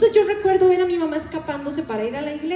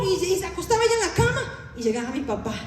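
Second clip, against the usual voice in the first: usual speaking voice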